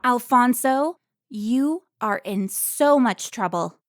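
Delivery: loud, slow telling-off; scolding. Acted. Carmen scolding Alfonso on finding him, loud and stern. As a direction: let him know he's in trouble.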